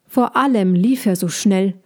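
plain reading voice

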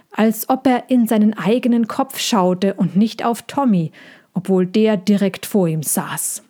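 ordinary delivery, nothing marked

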